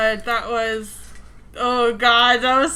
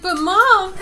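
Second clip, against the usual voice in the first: higher, lightly voiced pleading child; little kid; 7 semitones higher, 4 dB louder than usual. Little kid protests being sent away, higher child voice. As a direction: imitate a child who's been brushed off. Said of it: "it's like little kids when they're like".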